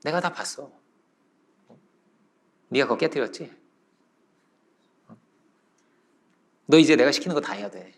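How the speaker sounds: low, quiet, knowing threat; quietly corner them with what you saw; hushed, low-voiced blackmail; blackmailing child. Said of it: "그 아이가 하는 말이 뭐냐면".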